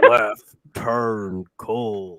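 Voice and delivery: slow, flat robot announcer; robotic voice. Retold or imitated. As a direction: drag it out like a robot. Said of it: "Jacob in a robotic voice".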